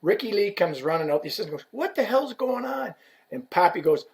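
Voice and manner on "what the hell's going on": high-pitched retold demand to know; alarmed demand. Man running out at the yelling, high alarmed demand. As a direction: burst in asking, voice pitched up.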